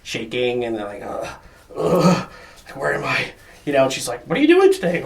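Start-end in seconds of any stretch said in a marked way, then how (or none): 1.0-3.3 drowsy groans, then a confused question; half-awake groaning, lost; groggy groan. Retold or imitated. Groggy self woken by alarm. 4.3-5.0 much higher, louder chirpy morning voice; perky girlfriend. Retold or imitated. Voicing chipper girlfriend in morning.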